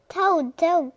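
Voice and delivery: high loud call; loud eager calling; cried out. A narrator's reading. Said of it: "cried Frog".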